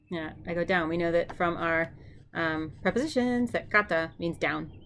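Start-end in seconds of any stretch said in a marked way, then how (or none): none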